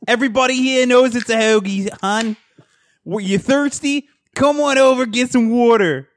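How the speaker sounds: put-on loud, high, drawn-out Philly accent; hammed-up, high-pitched Philly woman. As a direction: holler it in an exaggerated Philly accent. Acted Philly woman in improvised bit.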